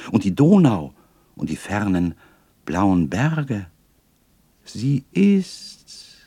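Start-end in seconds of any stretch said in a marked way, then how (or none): 4.6-6.2 higher, rising joyful recognition; delighted cry. A narrator's reading. Boy recognises the lady in the painting, delighted cry. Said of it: "rief ich endlich".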